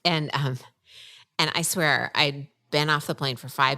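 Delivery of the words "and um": ordinary talk, nothing marked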